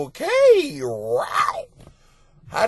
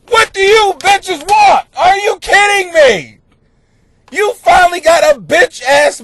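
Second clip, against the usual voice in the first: shouting, high and loud the whole way; exasperated rant; 7 semitones higher, 6 dB louder than usual. Acted. Host yelling at women who want both kinds of men, furious. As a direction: blow up at them in disbelief. Ranting at indecisive women listeners.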